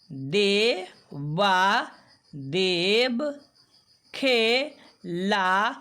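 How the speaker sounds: each syllable stretched slowly, then the word blended; syllable-by-syllable reading drill. Shown as a demonstration. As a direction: sound out each word for beginners, pausing between syllables. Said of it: "बारी बारी से पढ़ने का अभ्यास करेंगे".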